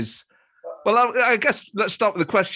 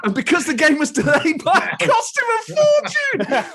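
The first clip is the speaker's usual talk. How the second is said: laughing; 9 semitones higher, 3 dB louder than usual. Real, not acted. Funny memory of friend's dad.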